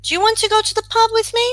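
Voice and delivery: fast rattled-off read. Shown as a demonstration. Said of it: "I can't use would and do together".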